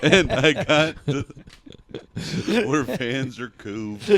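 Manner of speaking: slow, breaking up with laughter mid-line; can barely get the words out for laughing; laughing too hard to talk. Real, not acted. Max fixing his own joke to friends, helpless with laughter. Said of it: "Max is laughing so hard at his own stupid thing that he can't talk".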